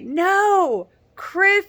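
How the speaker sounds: loud high protest; indignant no. Real, not acted. Viewer yelling at the TV about Chris, loud protest. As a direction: shout it at the screen in disbelief.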